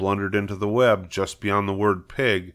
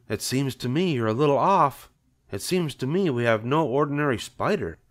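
higher-pitched, doubting wife; skeptical farm wife; 6 semitones higher than usual. Acted. Wife telling husband he's a little off, skeptical. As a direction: put him straight in a higher voice.

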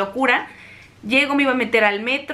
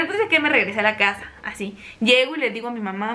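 normal talking voice

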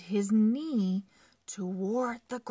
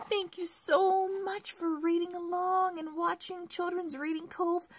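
cheerful outro; 9 semitones higher, much faster than usual. Real, not acted. Channel outro.

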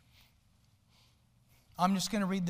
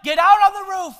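much higher, much louder urgent shout; father shouting up to his son in a burning house; dad yelling; 9 semitones higher, 14 dB louder than usual. Retold or imitated. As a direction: yell across to the son on the roof. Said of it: "the dad began to yell and sc-, scream to the son".

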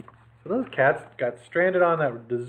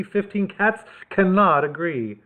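plain reading voice

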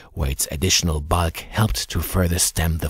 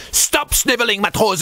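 high, loud military bark; barked order; 4 dB louder than usual. Acted. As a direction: bark it like a navy chief dressing down a sailor. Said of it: "the chief barked".

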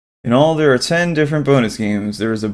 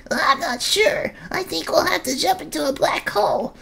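unsure squeaky creature; 5 dB quieter than usual. Acted. Squeak voiced.

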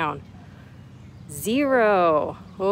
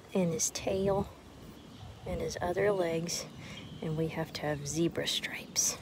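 soft whisper, much softer; 4 semitones lower, 12 dB quieter than usual. Acted. Teacher whispering to the kids over her drawing. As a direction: whisper it to yourself while drawing.

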